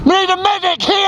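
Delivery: high urgent yell for medic; man down shout. Real, not acted. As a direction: shout it across the field. Calling medic for downed mate.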